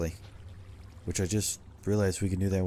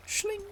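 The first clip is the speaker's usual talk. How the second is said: mock blade swish; 4 dB quieter than usual. Sound effect for an action.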